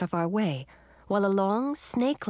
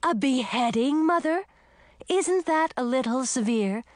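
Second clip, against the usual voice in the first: higher, louder outraged daughter; indignant shout; 6 semitones higher, 3 dB louder than usual. Acted. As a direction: protest loud in shock. Pearl shocked, angry.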